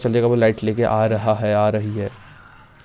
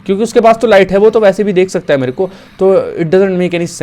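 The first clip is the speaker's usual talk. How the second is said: worked up; 7 semitones higher, 7 dB louder than usual. Real, not acted. Arguing the game logic.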